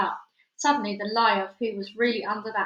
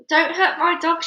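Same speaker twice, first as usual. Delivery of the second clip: high, loud protective cry; girl crying out to defend her pet; exclaiming; 8 semitones higher, 7 dB louder than usual. Acted. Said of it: "she exclaimed".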